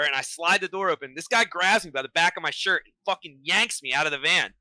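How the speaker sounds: normal talking voice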